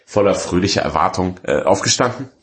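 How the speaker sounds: ordinary talk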